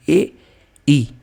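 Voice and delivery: ए then ई, each said separately and clearly as a model; vowel demo. Shown as a demonstration. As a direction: sound out ए and ई slowly so the tongue position shows. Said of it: "इसके उच्चारण में जीभ आपकी पीछे जा रही है".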